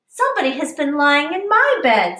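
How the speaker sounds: hint of the mother bear; middle-sized bear voice. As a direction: nudge the pitch up for mama bear. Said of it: "in her middle-sized voice".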